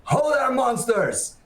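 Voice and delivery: hammy stage actor challenging monsters; theatrical voice. Acted. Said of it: "putting on the theatrical voice".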